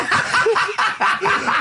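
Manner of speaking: sudden high burst of laughter; real laugh. Real, not acted. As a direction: break out laughing. Laughing at the game title.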